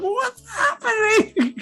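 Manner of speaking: high, slow, stretched-out panic; panicked question. Retold or imitated. Jace panics as the chess pieces mutate, high wail. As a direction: wail it in bewildered alarm.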